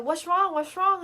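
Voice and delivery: high, loud repeated worried question; alarmed mom. Retold or imitated. Mom asking her frightened kid, alarmed and high. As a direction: imitate a parent in urgent concern.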